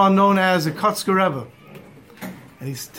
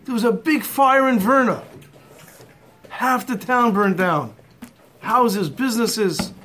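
mild mock yell, higher-pitched; retold scream; 4 semitones higher than usual. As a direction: retell the panicked news without really screaming. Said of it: "he screams out".

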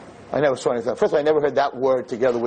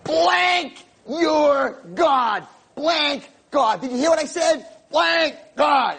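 furious shouting; 13 semitones higher, much slower than usual. Retold or imitated. Teen girl screams curses to make the rabbi angry, very high. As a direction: scream to provoke him. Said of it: "she has to get me angry".

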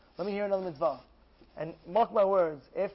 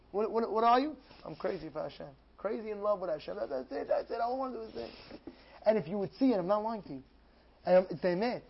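normal talking voice

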